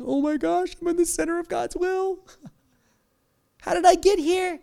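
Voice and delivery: high, delighted disbelief with a laugh; amazed. Retold or imitated. Believer amazed to find God's will, high and laughing. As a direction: gasp at where you ended up. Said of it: "be like".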